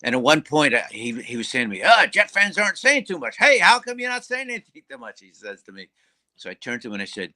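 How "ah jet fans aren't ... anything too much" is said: high, raised heckling; pitched-up mock heckle; obnoxious loudmouth fan. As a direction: imitate a loudmouth needling rival fans. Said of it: "I had a real loudmouth".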